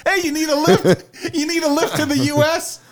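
very high, silly cartoon cabbie voice; high-pitched joke character calling out; squeaky cabbie. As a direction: squeak out an offer of a ride. Voicing the cabbie in the tunnel.

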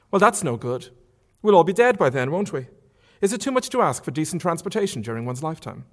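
mock outrage, lightly played; Marion.

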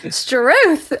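put-on loud London accent overshot southwards; accent gone too far south. Joking on a London accent, overshooting too far south. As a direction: overdo the southern accent into parody. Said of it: "I went too far south".

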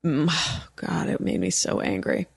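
low, soft groan of frustration; exasperated sigh. Real, not acted. Host worn out by techno rage, exasperated sigh. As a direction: let it out on a sigh, dropping low. Sighs after the rant.

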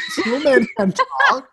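slow, high, loud amazed cry, laughing through it; amazed snowman. Retold or imitated. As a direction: gape at it in mock astonishment. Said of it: "He's like".

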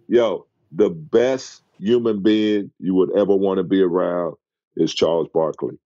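ordinary talk, nothing marked

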